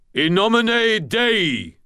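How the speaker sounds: high, slow, drawn-out bellow; bellowed battle cry. A narrator's reading. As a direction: bellow it like a holy war cry. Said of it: "Michael bellowed".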